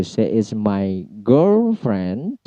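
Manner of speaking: put-on "girlfriend" with GIRL drawn out on a high tone. Shown as a demonstration. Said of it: "nada tinggi itu ditempatkan pada kata girl". (nada tinggi pada "girl" dalam "girlfriend")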